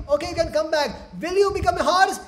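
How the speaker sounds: high, loud demand; bossy condition. Acted. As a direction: lay down terms to a playmate. Playmates setting condition.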